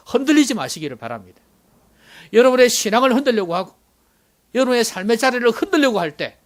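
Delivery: loud, impassioned sermon climax; full-force preaching. Real, not acted. Preacher urges the congregation to stand unshaken, forceful preaching. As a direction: preach it out loud, rising to a high peak.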